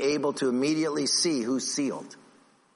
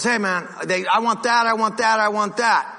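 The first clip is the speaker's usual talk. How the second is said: put-on whiny kid; 7 semitones higher, 5 dB louder than usual. Kid whining in store.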